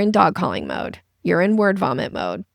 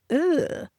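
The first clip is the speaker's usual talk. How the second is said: disgusted ugh; 8 semitones higher, 3 dB quieter than usual. Retold or imitated. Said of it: "people go like".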